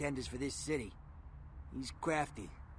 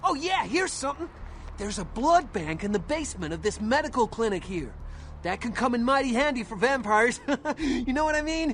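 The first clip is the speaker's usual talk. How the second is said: much higher and louder, bubbling over; eager greeting; 7 semitones higher, 8 dB louder than usual. Acted. Informant Knox gushing to the vampire, loud and excited. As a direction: gush like an overeager informant.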